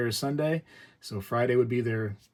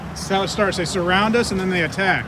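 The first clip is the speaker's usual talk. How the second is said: hammed-up alarm, higher and louder; mock alarm; 8 semitones higher, 7 dB louder than usual. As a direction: play up the danger, loud and high. Mock alarm, repeat of 113-114.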